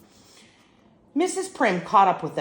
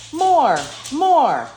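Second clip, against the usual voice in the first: loud, high cries of more; shouting crowd; 8 semitones higher, 8 dB louder than usual. A narrator's reading. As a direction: bellow it like a cheering crowd.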